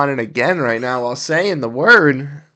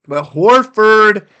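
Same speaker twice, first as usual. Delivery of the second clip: excited call; 7 semitones higher, 8 dB louder than usual. Real, not acted. Calling a big shot.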